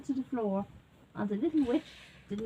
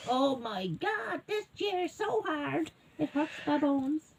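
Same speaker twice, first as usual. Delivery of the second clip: put-on slightly higher, drawn-out girl's complaint; lightly voiced girl grumbling, higher and slow; complaining little girl; 6 semitones higher, slower than usual. A narrator's reading. Little girl trying the biggest chair, mildly complaining. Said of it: "And she said".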